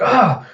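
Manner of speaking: short, high startled cry; freaked-out yelp. Retold or imitated. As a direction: imitate a panicked samurai's yelp. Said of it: "samurai just freaking out".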